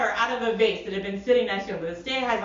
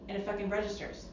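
normal talking voice

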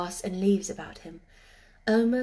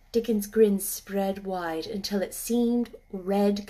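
usual speaking voice

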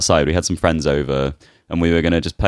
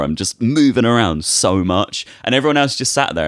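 normal talking voice

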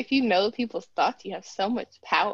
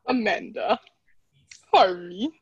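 put-on terrible British accent; slower than usual. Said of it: "You had a terrible British accent".

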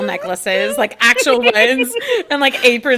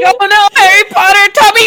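mock British accent; 8 semitones higher, 7 dB louder than usual. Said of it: "say it in Britain".

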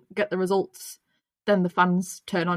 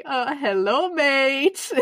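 imitating Carlos; 4 semitones higher, much slower than usual. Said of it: "then he was like".

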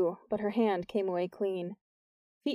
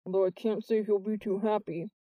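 mumbled. A narrator's reading. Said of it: "Jordan mumbled".

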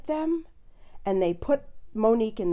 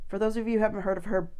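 usual speaking voice

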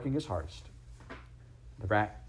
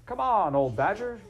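higher, louder call across the bank; shout; 12 semitones higher, 6 dB louder than usual. A narrator's reading. Rat calling the shy badger out of the hedge, loud shout. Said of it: "shouted the rat".

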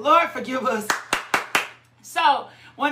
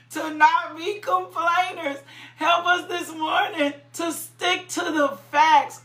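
ordinary talk, nothing marked

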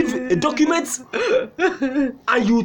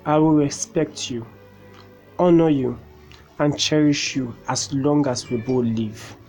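saying wedding vows; 10 semitones lower, 4 dB quieter than usual. Acted. Groom says his wedding vows.

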